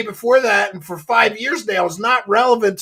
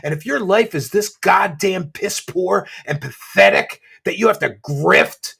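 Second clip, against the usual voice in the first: usual speaking voice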